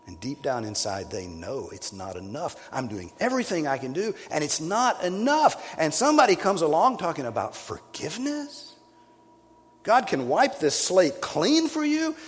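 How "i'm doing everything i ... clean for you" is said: loud, high, ringing sermon climax; full-force preaching. Acted. Preacher thundering at the moral churchgoers, full-force preaching. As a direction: preach it out hard to the congregation.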